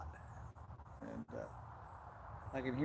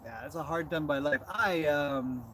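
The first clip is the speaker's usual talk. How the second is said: ordinary talk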